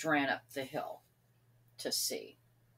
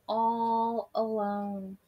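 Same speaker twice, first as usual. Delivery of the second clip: lonely, slow; much slower than usual. A narrator's reading. Sam returns alone.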